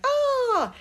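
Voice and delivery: high squeal of toy-unboxing wonder; mock delighted gasp. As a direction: imitate an adult gushing over a new toy. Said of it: "being like".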